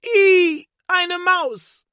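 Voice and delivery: shrieking scream. A narrator's reading. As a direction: scream at the mouse in panic. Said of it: "schreit die Frau im Kiosk plötzlich". (die Verkäuferin kreischt panisch wegen der Maus)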